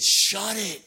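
sharp silencing. Acted. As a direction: shout him down. Crowd silences the beggar.

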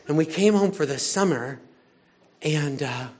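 ordinary talk, nothing marked

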